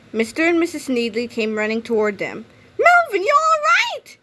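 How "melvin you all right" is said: very high, loud worried mother's voice; a frantic mom calling to her son; anxious mother's cry. A narrator's reading. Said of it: "cried Missus Needley".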